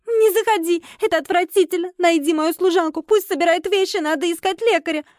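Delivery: high-pitched mild wailing; sobbing. A narrator's reading. Guest shut in the bathroom, sobbing. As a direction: wail it in high, tearful distress. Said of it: "рыдала там девушка".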